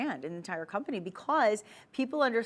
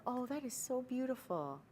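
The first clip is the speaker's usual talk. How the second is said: soft, high-pitched swoon; hushed, higher admiring tone; 5 semitones higher, 8 dB quieter than usual. Acted. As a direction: coo it softly, in awe. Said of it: "you say it in that voice".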